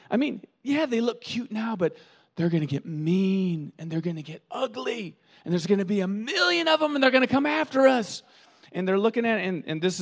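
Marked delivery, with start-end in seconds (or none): 0.1-8.2 high, frantic warning; panicky sidekick. Retold or imitated. Sidekick warns her about aliens, high and frantic. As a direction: rattle off the danger in a panic. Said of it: "and says".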